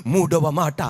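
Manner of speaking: usual speaking voice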